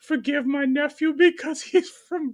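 high, loud laughter through the words; can barely get it out for laughing. Real, not acted. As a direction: crack up while telling it. Laughing retelling grandfather.

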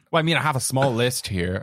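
ordinary talk, nothing marked

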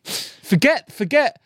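squeaky, hammed-up telling-off; exasperated director. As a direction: scold a wayward actor in a high, pleading voice. Acting out exasperated director scolding actor Jerry.

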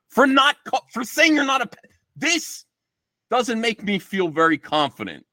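very high-pitched, exasperated sputter; exasperated disbelief. Retold or imitated. Replaying his reaction to Vito's thanks, high exasperation. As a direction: break off mid-phrase, voice jumping way up.

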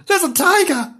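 high-pitched cry of alarm; high storybook fright; acted alarm. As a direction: cry out in mock panic. Rosie takes fright in leaves.

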